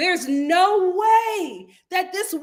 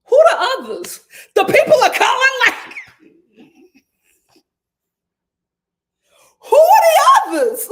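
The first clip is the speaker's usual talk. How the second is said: higher and louder, laughing in disbelief; outraged; 9 semitones higher, 10 dB louder than usual. Real, not acted. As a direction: shout it through a laugh. Outraged reaction to Dorit quote.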